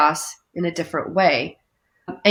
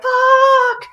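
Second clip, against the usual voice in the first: high, loud burst; child's outburst; 7 dB louder than usual. Pretend. Chaos as a child bursting into the room, high loud cry. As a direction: blurt it like an upset child. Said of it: "a child that's come into the room".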